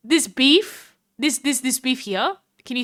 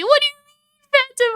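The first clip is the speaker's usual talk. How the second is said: much higher and louder, baffled; high loud outraged question; shocked squeal; 13 semitones higher, 3 dB louder, faster than usual. Real, not acted. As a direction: yelp in disbelief. Surprised by quiz answer.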